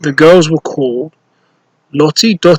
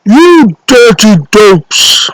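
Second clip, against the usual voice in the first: slow, loud, higher angry boom; giant booming; 5 semitones higher, 4 dB louder, much slower than usual. Acted. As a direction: boom it at the lazy cleaners. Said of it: "boomed the giant".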